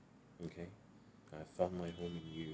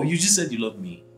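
excited; 7 semitones higher, 8 dB louder than usual. Acted. Excited after confession.

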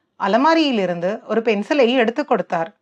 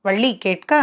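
ordinary delivery, nothing marked

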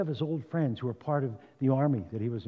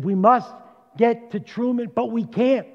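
higher, louder urgent cry of a desperate people; desperate plea; 8 semitones higher, 8 dB louder than usual. Retold or imitated. Preacher voicing Israel's desperate cry to reach Truman, urgent and raised. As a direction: plead it out, rising and loud.

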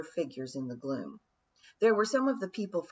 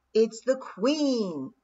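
slow, louder alarmed yell; yelled warning; 5 semitones higher, 6 dB louder, much slower than usual. A narrator's reading. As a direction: shout the alarm. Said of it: "yelled her third thoughts".

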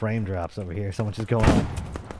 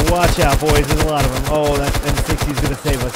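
loud warning shout to squad; combat callout; 4 semitones higher, 9 dB louder than usual. Real, not acted. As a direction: call it out over gunfire.

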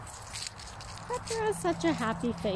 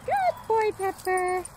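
squeaky praising a dog; 8 semitones higher than usual. Real, not acted.

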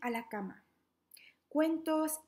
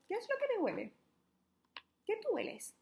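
put-on high, bright question to children; asking the kids; 9 semitones higher, faster than usual. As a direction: lift the voice way up to ask the little ones.

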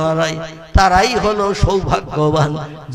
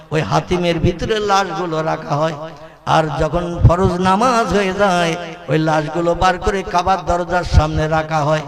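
normal talking voice

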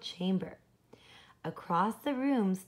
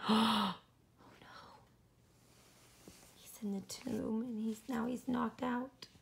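worried hushed whisper; 2 semitones higher, 6 dB quieter than usual. Real, not acted. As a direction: whisper as if scared to wake him. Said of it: "worried aside to listeners".

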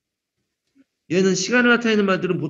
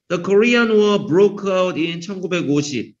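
slow, deliberate, louder reading of the sentence; slow example reading; 3 dB louder, much slower than usual. Shown as a demonstration. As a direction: read it out slowly for the class. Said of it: "teacher reads example sentence".

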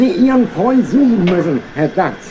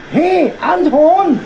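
hailing a coworker; calling out; 6 semitones higher, much slower than usual. Acted. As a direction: shout a name across the yard. Calling the porter over.

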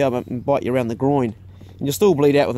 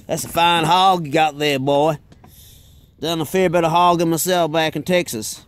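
lightly put-on Texan accent; a hint of a Texan hunter bragging; Texan accent, just sketched in; American accent. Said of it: "he goes".

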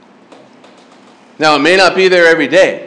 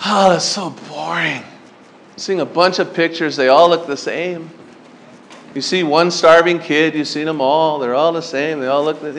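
put-on soft, dismissive teenage scoff; a mild mock of a bored kid; 4 dB quieter than usual. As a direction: roll your eyes through it. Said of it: "I'd be like".

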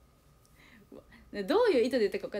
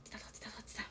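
息だけでつぶやく; 声を消して自問する; ひそひそ声; 15 dB quieter, much faster than usual. Retold or imitated. 小声でつぶやく心の声. (ささやき声で)

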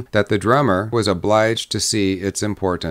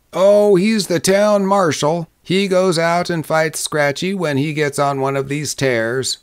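higher, emphatic Western local; explaining townsman; 7 semitones higher than usual. A narrator's reading. Townsman telling the drummer about the marshal, emphatic. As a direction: open strongly, then explain.

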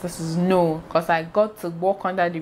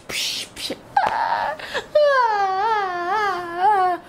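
whispered psh then high wailing ah; mock crying sobs; 14 semitones higher, much slower than usual. Girl finally crying in the car, hammed-up mock sobbing. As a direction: act out bursting into tears. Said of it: "the tears just were".

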